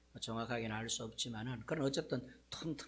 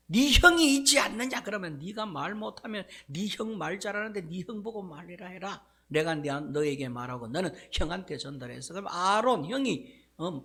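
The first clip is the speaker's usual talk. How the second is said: God chiding Moses, much higher and louder at first; angry scolding; 5 semitones higher, 6 dB louder than usual. Acted. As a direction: scold him like a furious father. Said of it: "하나님께서 또 모세를 막 뭐라 합니다".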